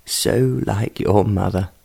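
soft, slow, choked with emotion; choked whisper. A narrator's reading. Father to daughter of her late mother, soft, slow and choked. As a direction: hush it, voice catching on grief. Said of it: "whispered ... choked with emotion".

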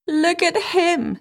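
high, slow jeering voice; a high, drawn-out taunt; mocking princess. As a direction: sneer it out, mocking the old king.

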